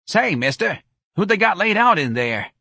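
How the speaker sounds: high-pitched call to a stranger; nosy local woman. Acted. Woman asking mourner who is laid out, brash.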